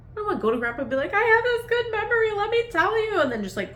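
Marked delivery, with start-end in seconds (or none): 1.1-3.2 high, loud, mock crying voice; mock tearful. Imagining telling grandpa a memory, hammed-up teary voice. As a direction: pretend to blubber out the memory. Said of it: "cry at him".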